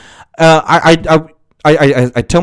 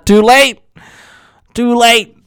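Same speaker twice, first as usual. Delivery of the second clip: much higher sing-song teasing; mock taunt; 11 semitones higher, slower than usual. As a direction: tease a caller who left too soon, playfully. Mock taunt about a job regret.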